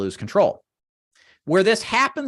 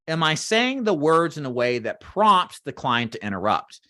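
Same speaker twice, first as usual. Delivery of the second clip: usual speaking voice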